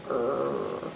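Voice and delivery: "Er" very low, soft and held; growl-like R. Shown as a demonstration. Reader repeating the R sound, growl-like "Er". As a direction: let "Er" rumble low like a growl. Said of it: "kinda like a growl".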